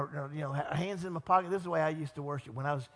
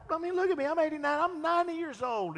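mock disbelief; 11 semitones higher, 4 dB louder than usual. Voicing Sarah's disbelief.

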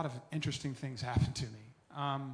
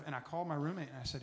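normal talking voice

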